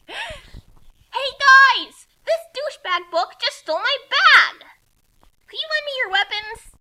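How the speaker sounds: high, loud call. Acted. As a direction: yell across to the guys. Retake with corrected word.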